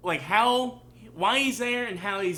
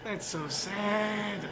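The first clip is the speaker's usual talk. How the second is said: slow, higher sympathetic lament; drawn-out pity; 4 semitones higher, much slower than usual. Real, not acted. Viewer pitying the characters on screen, drawn-out pity. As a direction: stretch it out with real pity. Moved viewer reacting to scene.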